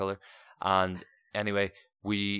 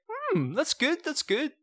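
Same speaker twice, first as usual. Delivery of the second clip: very high, strained politeness; courteous; 4 dB louder, slower than usual. Retold or imitated. Guest tasting awful soup to please hosts, falsely courteous. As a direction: fake approval in a squeaky-high pitch. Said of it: "obviously courteous".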